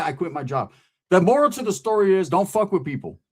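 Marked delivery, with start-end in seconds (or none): none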